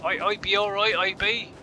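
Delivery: broad Bristolian dialect, pitched a little up; Bristolian accent. Shown as a demonstration. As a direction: show off a Bristol accent in fun. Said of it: "Like, Bristolian".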